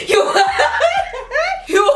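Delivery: high laughing fit breaking off the words; shrieking laugh between attempts to speak; cracking up. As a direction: burst into long, helpless, high laughter. Cracking up while retelling.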